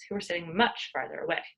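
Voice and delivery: plain reading voice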